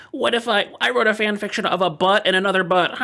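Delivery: high, silly voice of a meme fanfic writer; mock silly fanfic writer. Host mocking meme fanfic writers, high and silly. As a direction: ham up a goofy fan pitching a dumb idea. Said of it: "people that are just like".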